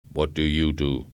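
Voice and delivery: cold, even robot pitch; robot salesman. A narrator's reading.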